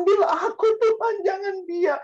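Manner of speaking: very high, loud mock-crying plea; imitated tearful wailing. Mother begging to die in her child's place, imitated wail. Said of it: "mamanya di samping peti itu ya nangis-nangis".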